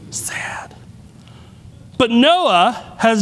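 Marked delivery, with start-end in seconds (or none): 0.1-0.7 a soft breathy whisper, almost no voice; hushed whisper; whispered aside. Acted. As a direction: drop to a whisper for one word. Aside after a sad moment.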